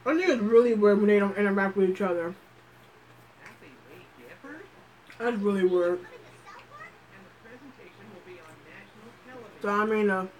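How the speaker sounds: talking with a mouth full. Real, not acted. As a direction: speak through a mouthful of food. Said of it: "talk with my mouth full, sorry".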